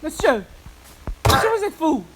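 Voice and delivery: high loud call. Real, not acted. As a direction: shout after the villager. Calling villager, shouting.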